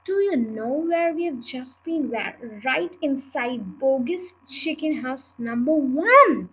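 usual speaking voice

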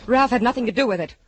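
shouting him down; loud desperate insistence; loud protest. Acted. Desperate confession quarrel.

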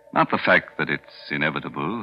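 ordinary talk

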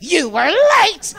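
high, drawn-out imitation of an agent; mock scolding yell. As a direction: throw back the blame, hammed up and slow. Agent's retort acted out bigger.

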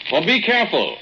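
calling out. Acted. Calling after Lucille in the cave.

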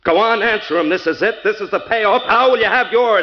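high, loud, insistent shouting; furious demand. Acted. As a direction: explode and force an answer. Husband explodes, demanding her answer.

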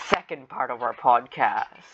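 usual speaking voice

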